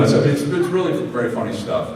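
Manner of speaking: ordinary talk, nothing marked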